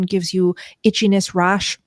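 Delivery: ordinary delivery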